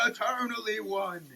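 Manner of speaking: ordinary talk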